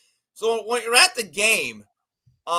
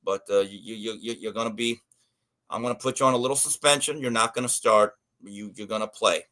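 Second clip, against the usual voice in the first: disappointed coach; 10 semitones lower, 5 dB quieter than usual. Retold or imitated. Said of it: "and he goes".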